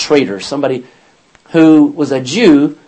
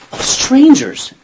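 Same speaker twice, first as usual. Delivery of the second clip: Peter's answer; 10 semitones higher, 6 dB louder, slower than usual. Retold or imitated.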